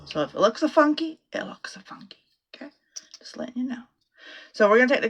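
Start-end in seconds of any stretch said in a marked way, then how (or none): 1.3-2.7 hushed repeat of the warning; whispered aside. Shown as a demonstration. 3.2-3.8 hushed confiding aside; whispered aside. Real, not acted.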